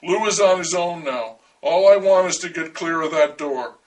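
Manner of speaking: usual speaking voice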